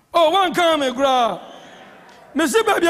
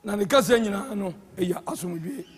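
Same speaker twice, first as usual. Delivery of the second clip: soft, low and settling into a hush; speaking gently; 8 semitones lower, 6 dB quieter than usual. Shown as a demonstration. Preacher showing the blood's peaceful speech, gently hushed. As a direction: let the voice sink, peaceful and quiet. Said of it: "ɛkasa borɔkɔɔ".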